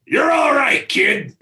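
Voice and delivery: high, cracking shout of approval while laughing; George's gruff approval. Retold or imitated. Director imitates George praising him in front of crew, gruff shout. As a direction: bellow it like a grizzled general. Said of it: "he turns around and goes".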